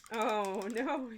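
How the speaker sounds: a shaky stab at a Scottish "Oh no"; half-hearted Scottish accent; bad Scottish accent. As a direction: slip into a clumsy Scottish accent. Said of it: "Your Scottish got so bad".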